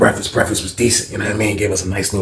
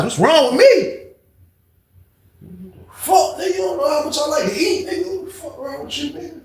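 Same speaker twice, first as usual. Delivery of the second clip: angry snap. Retold or imitated. Snapping at roommate in anger.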